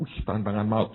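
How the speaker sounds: ordinary delivery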